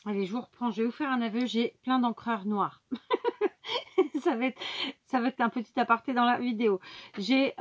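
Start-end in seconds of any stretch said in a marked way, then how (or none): 2.9-4.2 high-pitched giggle; rire. Real, not acted. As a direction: burst into a high laugh at your own confession. Rit de son propre aveu. (petit rire aigu)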